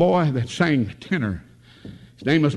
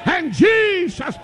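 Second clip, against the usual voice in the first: preaching at full force. Acted. Preacher shouting the lesson to the congregation. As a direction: drive it home to the pews.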